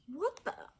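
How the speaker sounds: put-on startled boy at the ghosts; startled gasp. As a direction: jump and gasp it out. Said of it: "he gasped".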